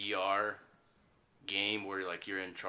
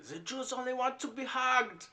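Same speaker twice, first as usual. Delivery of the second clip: put-on high, laughing German accent; 13 semitones higher than usual. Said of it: "I miss your German accent".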